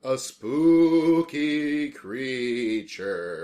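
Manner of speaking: slow, loud eerie ghost voice; spooky voice. Pretend. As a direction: draw it out like a haunting. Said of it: "I really like making this spooky voice".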